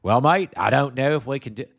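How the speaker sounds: hammed-up Australian accent, leaning on mate; Justin Wolfers Australian impression. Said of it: "gotta work on an Australian accent".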